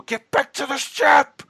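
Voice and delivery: high, slow, loud bellowing yell; desperate yell. Retold or imitated. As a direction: bellow it like an old man in agony. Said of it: "that yell of him".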